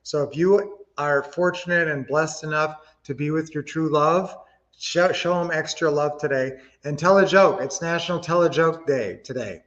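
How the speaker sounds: ordinary delivery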